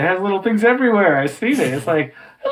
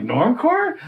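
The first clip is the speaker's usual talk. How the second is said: puzzled disbelief; 7 semitones higher than usual. Retold or imitated. Acting out own puzzled reaction.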